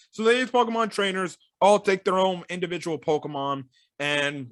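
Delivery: usual speaking voice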